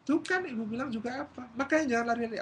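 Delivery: high-pitched scolding at moderate volume; restrained mock scolding; nagging mother. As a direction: tell the child off, I-told-you-so. Mother scolding, repeated less loudly.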